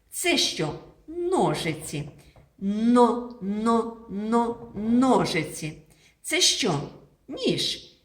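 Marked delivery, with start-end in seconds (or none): 2.6-4.7 но, но, но chanted clearly; teacher drilling the syllable но; repeated syllable drill. Shown as a demonstration. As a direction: tap out но, но, но for the class. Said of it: "Вимовляємо н".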